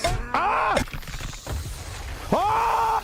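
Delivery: high-pitched panicked scream; screaming for dear life. Real, not acted. Player fighting for their lives, high scream. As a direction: scream as if your life depends on it. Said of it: "I'm screaming for our lives".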